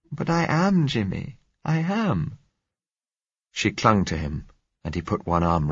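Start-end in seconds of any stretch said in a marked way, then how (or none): 0.1-2.4 high, scared woman's voice; clinging and afraid; frightened woman. A narrator's reading.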